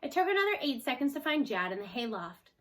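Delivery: usual speaking voice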